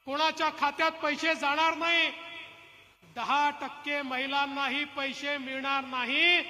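ordinary talk

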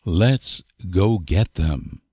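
normal talking voice

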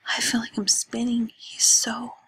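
breathless, hushed gushing; whispered infatuation; dreamy whisper. Acted. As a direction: whisper it giddy with crush. Lena swoons over a boy at night.

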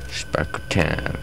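slow hushed whisper. Pretend. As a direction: breathe it out slowly. Repeated phrase, whispered.

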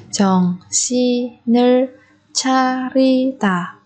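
"정신을 차리다" slowly, syllable by syllable; drawn-out teaching model of "정신을 차리다". Shown as a demonstration. Teacher repeats phrase syllable by syllable.

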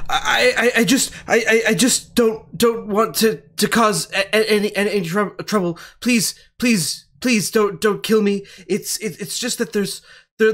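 high, stammering plea with a nervous laugh; terrified stammer. Acted. Captured doctor begging the interrogators not to kill him, stammering. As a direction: beg for your life, tripping over the words. Threatened doctor begs for his life.

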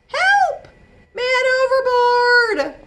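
high, loud cry for help; calling for help. Acted. Drowning boy calls to everyone, high panicked shout. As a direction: yell it from the water.

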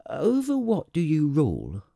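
put-on high, wondering child-prince question; inquiring little prince. Little prince questions the king's reign, wondering. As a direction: ask the monarch in a high, earnest voice.

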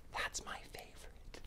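confiding whisper; barely audible, whispered confession; whispered aside. Real, not acted. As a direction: whisper it like a little secret favorite. Confiding aside about making tea.

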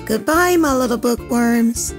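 ordinary delivery

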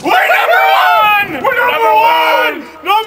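shouted cheer. Acted. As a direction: cheer it out loud and high. Cheering loudly in the bloopers.

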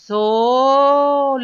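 stretched-out blending of sol; blended sound. Shown as a demonstration. Teacher blending sounds for children, slowly. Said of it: "Vamos a unir los sonidos".

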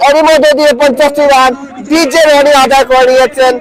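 ordinary delivery

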